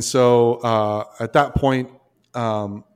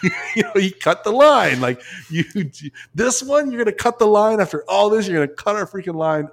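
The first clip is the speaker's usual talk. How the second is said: much higher, louder, disbelieving accusation; a diver venting at the instructor who cut the line, higher and louder; indignant rant; 9 semitones higher, 4 dB louder than usual. Retold or imitated. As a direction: blame him with rising outrage. Retold outrage at instructor.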